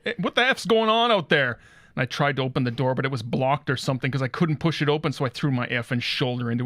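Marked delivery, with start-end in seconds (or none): 0.0-1.6 higher, louder "what the F" shout; confused yell. Retold or imitated. Locked in the bathroom, he yells at the noises outside, startled. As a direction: bellow it through the bathroom door. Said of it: "and I go".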